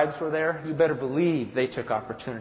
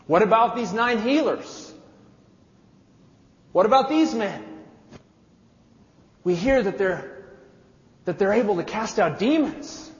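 crowd calling out; 5 semitones higher than usual. Acted. Someone in the crowd yells out.

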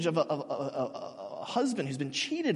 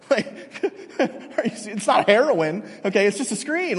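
ordinary talk

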